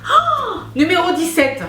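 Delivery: very high, loud oh; opening the next box, delighted; thrilled squeal. Real, not acted.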